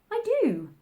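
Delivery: high, quick eager reply; hasty reply. A narrator's reading. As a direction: blurt it out fast to defend herself. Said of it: "Alice hastily replied".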